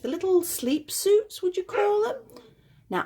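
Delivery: usual speaking voice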